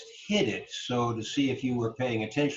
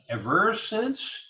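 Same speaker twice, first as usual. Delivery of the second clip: "ever" as e-VER, second syllable high; wrong-syllable stress; 10 semitones higher, slower than usual. Shown as a demonstration. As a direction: slowly lift the VER of "ever". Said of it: "doesn't make sense".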